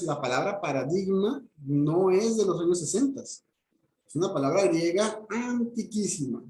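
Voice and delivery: ordinary talk, nothing marked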